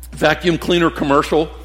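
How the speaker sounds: ordinary talk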